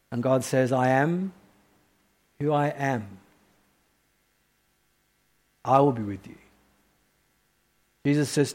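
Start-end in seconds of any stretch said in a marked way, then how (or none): 0.7-6.3 soft, low, calm; a touch of divine calm; quiet solemn answer. Acted. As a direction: speak it with hushed weight. Voicing God's answer.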